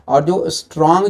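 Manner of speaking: ordinary talk, nothing marked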